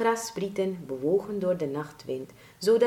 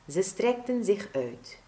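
ordinary delivery

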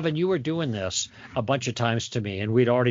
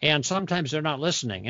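ordinary talk, nothing marked

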